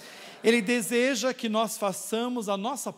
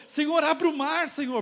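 cry to God; 7 semitones higher than usual. Retold or imitated. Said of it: "Ele gritou".

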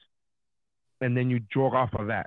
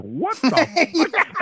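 high, drawn-out disbelief; baffled high-pitched outburst; dumbfounded; 14 semitones higher, 4 dB louder, much slower than usual. Retold or imitated. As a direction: retell it as a stunned, soaring question. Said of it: "were both dumbfounded".

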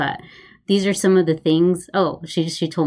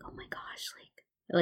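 hushed whispered aside; 17 dB quieter, slower than usual. Real, not acted. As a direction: drop to a hush as you describe them. Describing customers reminiscing.

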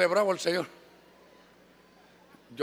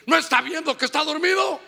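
higher, louder indignant snap; angry retort; 9 semitones higher, 5 dB louder than usual. Retold or imitated. Angry mother snaps at the pastor over her sleeping child. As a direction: snap back furiously.

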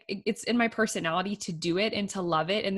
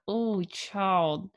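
weary mom sigh. Retold or imitated. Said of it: "And I'm like".

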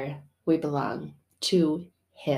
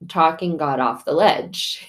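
ordinary talk, nothing marked

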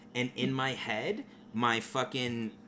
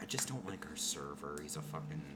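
pouting mutter; 7 semitones lower, 12 dB quieter than usual. Retold or imitated.

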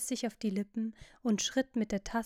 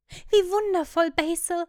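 higher and louder, marvelling; delighted exclamation; 8 semitones higher, 9 dB louder than usual. Acted. Young man marvelling to the painter at his portrait, delighted. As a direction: exclaim it high and loud in delight.

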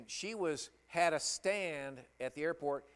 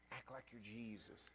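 hushed repeat; 13 dB quieter than usual. Shown as a demonstration.

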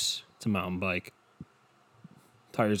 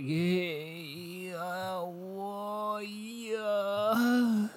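high, very slow, stretched out greeting; old man waving from the sidewalk, high and drawn out; old neighbor impression; 11 semitones higher, much slower than usual. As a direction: imitate the old man across the street. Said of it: "That's what he sounded like".

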